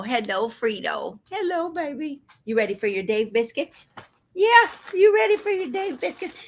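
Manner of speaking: high cooing voice for the dog; pet talk. Real, not acted.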